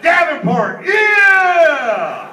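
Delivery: high, full-throated shout; mock hometown cheer. Comic threatening Texans with Iowa people, shouted cheer. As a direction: whoop it like a rowdy Iowan. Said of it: "sick the Iowa people on you".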